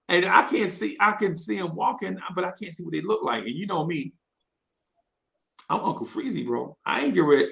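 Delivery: usual speaking voice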